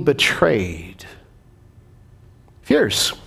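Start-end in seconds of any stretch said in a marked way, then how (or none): none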